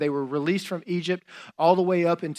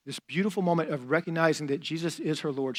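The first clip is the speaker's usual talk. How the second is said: ordinary talk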